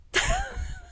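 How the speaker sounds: short high embarrassed laugh. Real, not acted. Laughs at embarrassing guilty pleasure.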